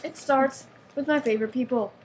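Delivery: mock fan freak-out. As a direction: gush like an overexcited fan. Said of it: "And he's freaking out".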